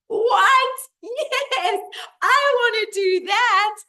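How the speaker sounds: squealed, very high and loud disbelief turning to joy; acted ecstatic squeal; excited little soul. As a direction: shriek it with over-the-top delight. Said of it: "says the little soul".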